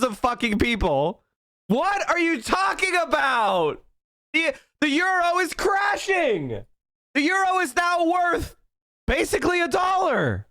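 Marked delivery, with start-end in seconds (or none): 1.7-10.5 heated rebuttal. Real, not acted. Arguing back at the clip.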